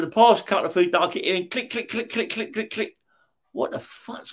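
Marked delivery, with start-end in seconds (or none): 1.7-3.0 soft clicking sounds, much softer; mock typewriter clicks. Retelling the noise from upstairs at night, soft mock clicks. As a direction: tap out the clicks under your breath.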